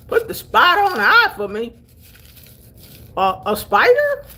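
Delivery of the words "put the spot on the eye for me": put-on puzzled city girl. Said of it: "I'm like".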